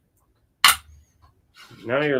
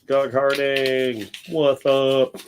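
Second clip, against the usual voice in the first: slow, sing-song hello; slow drawn-out greeting; 3 semitones higher, much slower than usual. Real, not acted. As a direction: stretch the name out slowly.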